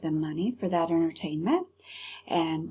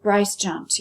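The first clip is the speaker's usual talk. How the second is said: usual speaking voice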